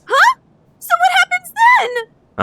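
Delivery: high-pitched disbelief; shocked high. Acted. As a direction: squeak it out stunned. Shocked at twist.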